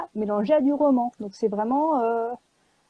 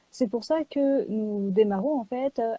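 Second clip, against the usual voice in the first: ordinary talk, nothing marked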